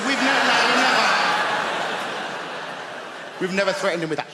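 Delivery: stammering denial through laughter; flustered laughing denial. Retold or imitated. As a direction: deny it while cracking up. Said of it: "I said".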